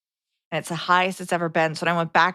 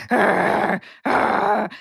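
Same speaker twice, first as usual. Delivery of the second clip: mock dog growl; 3 semitones higher, 5 dB louder than usual. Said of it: "And she's growling".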